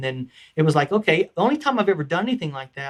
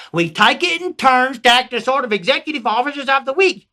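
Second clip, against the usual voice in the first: Southern peasant; 7 semitones higher, 3 dB louder than usual. Acted. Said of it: "I changed it to a Southern".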